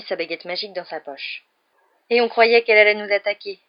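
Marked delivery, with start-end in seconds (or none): 2.1-3.6 loud, relieved exclamation; exclaiming. A narrator's reading. Ron leaning on the car after the scare, exclaiming loudly. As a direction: exclaim it in a raised voice. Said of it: "s'exclama Ron".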